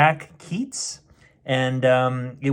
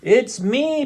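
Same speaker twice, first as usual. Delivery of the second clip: mild shout; 10 semitones higher than usual. Acted. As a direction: call it out brightly as the boy. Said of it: "Peter shouted".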